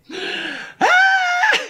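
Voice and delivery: sudden high yelp of laughter; shouted high "Ah!". Real, not acted. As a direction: let out a piercing cry. Laughing outburst.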